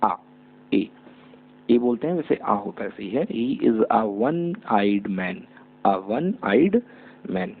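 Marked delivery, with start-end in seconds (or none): 3.3-7.5 very slow, word by word; slow dictation. Shown as a demonstration. Teacher dictating an example sentence to students. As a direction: spell each word out for the class. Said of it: "teacher models sentence slowly".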